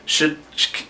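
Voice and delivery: usual speaking voice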